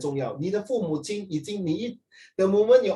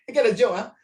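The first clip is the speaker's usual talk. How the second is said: usual speaking voice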